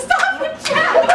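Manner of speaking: high laughing shout; cracking up mid-yell. Real, not acted. Laughing at a friend.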